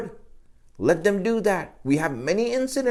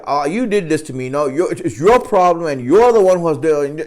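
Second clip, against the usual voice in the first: blaming, louder rant at God; mock accusing outburst, building louder; rude, random rant; 6 dB louder than usual. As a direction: imitate someone talking back as to a chump. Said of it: "he's just talking like that".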